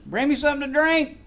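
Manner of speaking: mock king shouting for help from the ground; calling out. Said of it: "Oh, you would imagine he'd been".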